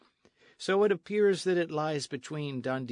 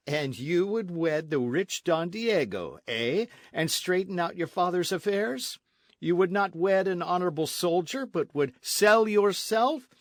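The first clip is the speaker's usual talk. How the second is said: insulting taunt; 6 semitones higher, 5 dB louder than usual. A narrator's reading. Captain accuses señorita of selling herself, taunting. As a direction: needle her, pushing harder to the end.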